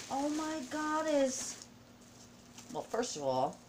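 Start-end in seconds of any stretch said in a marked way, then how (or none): 0.1-1.6 high, slow, drawn-out awe; awed exclamation. Real, not acted. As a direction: let it float up slowly, overwhelmed. Awed by the gift.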